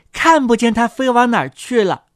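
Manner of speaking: plain reading voice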